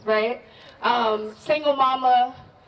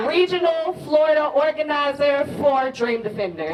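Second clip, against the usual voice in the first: ordinary delivery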